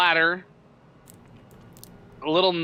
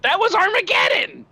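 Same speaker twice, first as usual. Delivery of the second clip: excited shout; 4 dB louder than usual. Real, not acted. Shouted outburst over movie banter.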